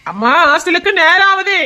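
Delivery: put-on high-pitched child's call to a parent. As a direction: call out to hurry them along. Child calls parents to get going.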